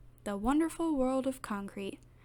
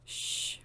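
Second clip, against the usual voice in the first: soft, voiceless "shh"; drawn-out library shush; 5 dB quieter than usual. A narrator's reading. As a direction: hush a noisy child in a library. Hushing Peppa in the library.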